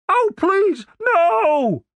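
very high, pleading wail; desperate high-pitched cry. Acted. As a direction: beg in panic, pitch way up. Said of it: "Denise's voice sounded desperate".

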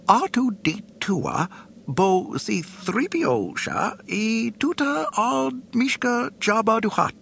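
fussy droid voice. Acted. C-3PO speaking alien language.

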